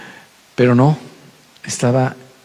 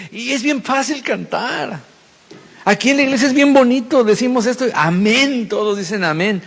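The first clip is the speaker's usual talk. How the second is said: high, sing-song mocking tone; mock sweet churchiness; 10 semitones higher than usual. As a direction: parody how easy it feels in church. Mocking easy church enthusiasm. (tono burlón y agudo de iglesia)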